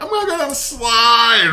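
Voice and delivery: put-on high shouted child voice; gleeful creepy kid shouting; demonic child.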